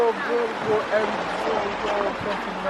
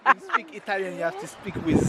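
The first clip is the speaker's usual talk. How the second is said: higher, softer mock Italian accent; put-on Italian accent; 5 semitones higher, 7 dB quieter, much faster than usual. Husband showing wife how Italians talk, mock Italian accent. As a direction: explain it in a playful fake Italian accent. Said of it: "Say something in an Italian accent".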